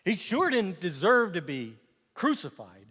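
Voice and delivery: ordinary talk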